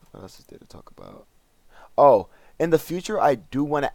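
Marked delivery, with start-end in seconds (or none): 0.1-1.3 whispered aside. Real, not acted. Sleepy aside to himself.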